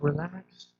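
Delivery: quiet soothing murmur to a fussing infant; soothing mother. Retold or imitated. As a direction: hush the baby with gentle reassurance. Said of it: "they would say something along the lines of".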